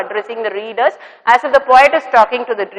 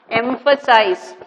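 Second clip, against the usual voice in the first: "Emphasize." said slowly and a bit softer, each syllable clear; slow teaching model; 4 dB quieter, slower than usual. Shown as a demonstration. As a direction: spell out "Emphasize." slowly for the class to learn. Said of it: "this word all of you have to learn".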